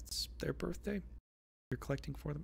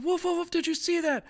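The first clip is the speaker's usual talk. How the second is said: high, loud yappy dog voice; barking little dog; 7 dB louder than usual. Acted. Little dog barking at you, high yapping voice. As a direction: bark it out like an excited little dog. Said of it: "And the other dog going".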